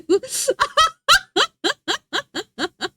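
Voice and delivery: long, very high laughing fit; cracking up completely; real helpless laughter. Real, not acted. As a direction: laugh in a high run of bursts. Cracking up at a chat message.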